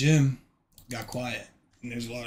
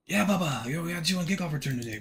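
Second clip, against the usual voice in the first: coach's voice; 6 semitones higher, 4 dB louder, faster than usual. Retold or imitated. Coach brushing him off.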